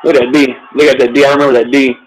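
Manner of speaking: loud, excited cheering at the game; loud excited fan. Real, not acted. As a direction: hype up the defense.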